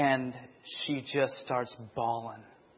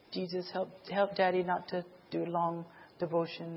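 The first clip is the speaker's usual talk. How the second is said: a light, higher child's voice, mock-sincere; child praying; 4 semitones higher, 5 dB quieter than usual. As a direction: imitate a little kid praying to Jesus. Little kids praying at home.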